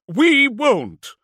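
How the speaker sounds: high yelled fragments; desperate, faltering yell; incoherent defiance. A narrator's reading. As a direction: blurt out broken refusals at full volume. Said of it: "He descended into an incoherence of defiance".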